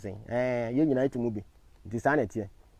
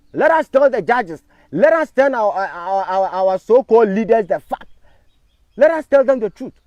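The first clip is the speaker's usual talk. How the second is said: higher and louder, slowing on the judges; loud rally speech; rallying call; 6 semitones higher, 8 dB louder than usual. Real, not acted. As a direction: rouse the crowd to speak up. Rallying call to tell the truth.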